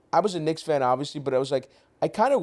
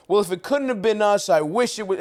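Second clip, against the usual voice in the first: mock benevolent; 6 semitones higher, 4 dB louder than usual. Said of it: "You get to have that benevolent".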